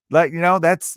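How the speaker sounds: normal talking voice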